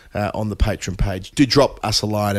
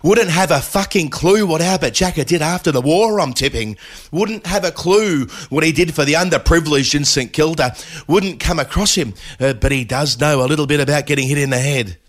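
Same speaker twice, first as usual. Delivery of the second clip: higher-pitched commentator imitation; Jeremy Coney; 4 semitones higher than usual. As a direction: chime in with an animated, lilting cricket pundit's voice. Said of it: "your best Jeremy Coney imitation".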